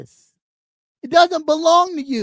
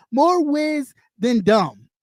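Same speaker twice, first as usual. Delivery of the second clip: normal talking voice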